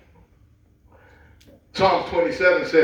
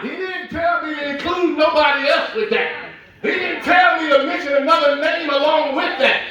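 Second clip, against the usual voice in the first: full-force preaching; 9 semitones higher than usual. Acted. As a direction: build it up to a shouting climax of conviction.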